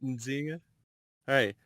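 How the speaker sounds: ordinary talk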